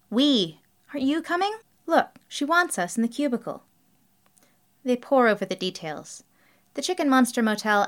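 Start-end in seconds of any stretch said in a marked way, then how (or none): 0.1-3.6 high-pitched, impatient prodding. A narrator's reading. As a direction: hurry a friend along impatiently. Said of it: "says Jerry impatiently".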